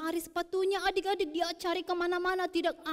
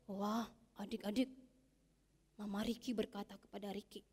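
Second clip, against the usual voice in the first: usual speaking voice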